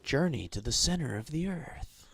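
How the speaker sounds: dramatic movie trailer announcer. Pretend. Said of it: "my movie trailer voice".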